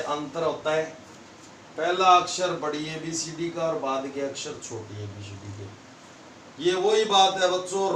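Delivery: ordinary delivery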